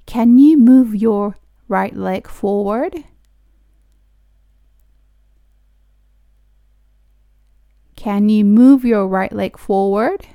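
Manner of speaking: slow, careful teacher pronunciation; slow model reading. Shown as a demonstration. Teacher reading slowly for students to repeat. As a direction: spell each word out slowly for learners. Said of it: "私と一緒に発音してもらったら".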